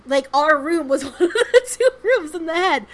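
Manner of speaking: cracking up as she tells it; giggling at the room in the head; laughing. Real, not acted. Laughing at penis-shaped layout.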